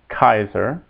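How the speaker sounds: "Kaiser" opening with a K sound; hard C. Shown as a demonstration. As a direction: say "Kaiser" as KAI-zer, hard K. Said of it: "C is always hard".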